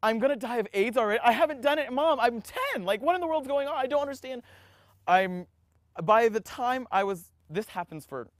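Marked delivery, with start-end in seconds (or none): 0.0-4.4 high, rushed plea, retold without real tears; mock-sobbing, high-pitched protest; imitated sobbing. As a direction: act out a panicked child pleading with mom. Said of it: "just sobbing, going like".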